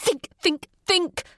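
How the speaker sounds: each "think" punched, rising to a sharp cry; each word hit. Acted. Panicked mother hitting her forehead, each "think" punched. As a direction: hammer every "think" like a blow. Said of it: "punctuated every word by hitting my palm".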